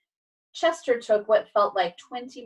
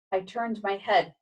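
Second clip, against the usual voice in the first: plain reading voice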